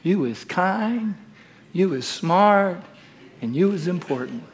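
almost whispered, very gentle; tender nanny voice. Acted. Ebeline to the little girl in her lap, soft tender words. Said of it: "and she says".

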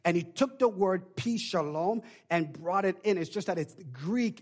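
ordinary talk

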